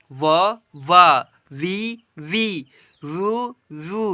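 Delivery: slow, drawn-out chant of each syllable; syllable drill. Shown as a demonstration. Teacher reading the chart to children, slow drawn-out recitation. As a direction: recite each letter clearly for pupils to repeat.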